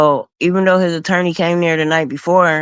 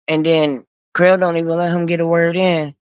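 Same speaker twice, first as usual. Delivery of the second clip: ordinary talk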